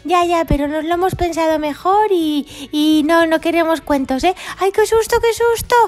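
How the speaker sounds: very high, fast mock fright; high frantic dinosaur fear; mock panic. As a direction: squeal in hammed-up terror. Dinosaurs refuse.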